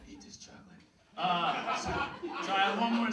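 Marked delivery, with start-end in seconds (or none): 0.1-0.6 very soft whispered mock threat; creepy furtive offer. Bully finding him years later to trick him, pretend whisper. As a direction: whisper it like a sneaky stalker.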